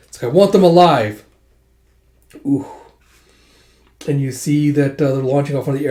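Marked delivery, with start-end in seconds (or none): 0.3-1.2 firm order. Retold or imitated. Advisor commanding archers.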